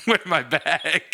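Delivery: laughing through the words, slowed; giggling while telling it; words broken by real laughter. Real, not acted.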